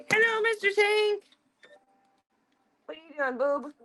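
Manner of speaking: high, sing-song voice for the dog; squeaky pet-talk greeting. Real, not acted.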